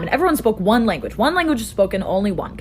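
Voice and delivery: ordinary talk, nothing marked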